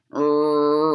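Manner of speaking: low drawn-out ribbit; slow froggy ribbit; frog croak. Acted. Frog sound in story.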